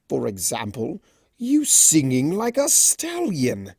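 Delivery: high, slow, drawn-out tease; teasing Discord. Acted. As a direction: savour the joke, mock sing-song.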